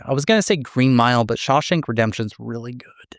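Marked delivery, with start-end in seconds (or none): none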